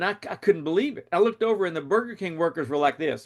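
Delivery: ordinary talk, nothing marked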